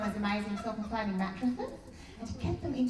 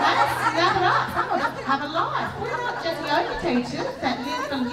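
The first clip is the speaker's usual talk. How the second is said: raised, louder and higher exasperation; impatient retort; 5 semitones higher, 5 dB louder than usual. Acted. Student telling yoga teacher to hurry, impatient and loud.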